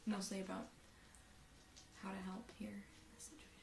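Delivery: soft whisper trailing away; hushed cover-up. Acted. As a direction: whisper it, fading as you go. Player covers gasp in hushed aside.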